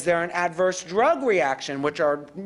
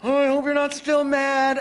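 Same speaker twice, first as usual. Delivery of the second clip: wrong anxious opener; 5 semitones higher than usual. Shown as a demonstration. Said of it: "I'm not gonna go in and say".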